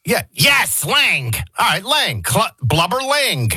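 high-pitched, emphatic giving-in; exasperated agreement. Retold or imitated. Host re-enacts the name argument, exasperated and high. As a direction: act out a worked-up back-and-forth.

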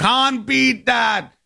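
high, drawn-out mock Jamaican; hammed-up Jamaican accent. Host mimics the Jamaican dad's catchphrase, exaggerated accent. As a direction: stretch it out slowly in a put-on Jamaican accent, pitched high. Host repeats the clip's phrase, bigger.

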